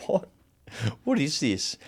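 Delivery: baffled question, acting out the shock; incredulous. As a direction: play back stunned disbelief. Retells his disbelief at new diagnosis.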